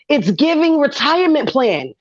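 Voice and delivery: normal talking voice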